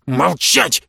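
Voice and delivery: ordinary delivery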